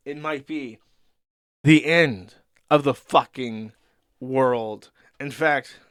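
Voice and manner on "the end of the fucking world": louder and slow, mock-apocalyptic; doom-laden outburst. Streamer declaring doom to chat, slow loud outburst. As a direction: drag out the end of the world like a prophet of doom.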